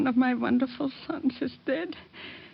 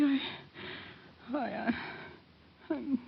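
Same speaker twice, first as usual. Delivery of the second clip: drowsy fading; 9 dB quieter, much slower than usual. Acted. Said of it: "I feel so sleepy".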